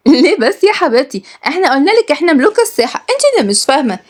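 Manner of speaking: put-on high-pitched, cocky kid; teasing child. A narrator's reading. Child boasting to Nour over cards, high and teasing.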